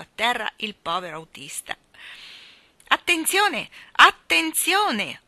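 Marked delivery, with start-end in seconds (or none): 2.9-5.2 booming shout, high and drawn out; voce tonante. A narrator's reading. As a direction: thunder out a warning, very loud. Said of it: "Una voce tonante". (voce tonante, acuta e prolungata)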